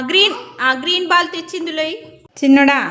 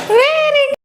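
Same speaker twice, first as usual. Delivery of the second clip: bright sing-song praise, much higher; high excited praise; 13 semitones higher, 4 dB louder, much slower than usual. Real, not acted. Mother praising her baby in high excited praise.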